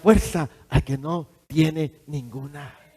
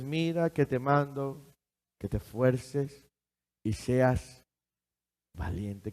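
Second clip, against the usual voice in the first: soft, lower reading of the verse; soft lower voice, verse offered as comfort; tender scripture reading; 4 semitones lower, 7 dB quieter than usual. Acted. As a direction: read the promise gently. Reciting the verse softly.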